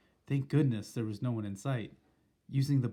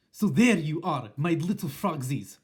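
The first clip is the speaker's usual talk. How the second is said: loud, slow, menacing witch voice; Grand High Witch; 4 semitones higher, 8 dB louder than usual. Acted. Witch gloating at the trapped frogs, loud and menacing. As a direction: croon a threat like a gloating witch. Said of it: "I heard her saying".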